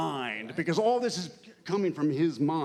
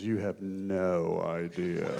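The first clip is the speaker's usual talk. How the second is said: low, slow, ominous delivery of the line; Jeremy Irons line; 7 semitones lower, 3 dB quieter, slower than usual. Retold or imitated. Actor quotes Jeremy Irons's line, low and slow. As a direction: let it drop low and slow with menace. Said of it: "he looks at him and goes".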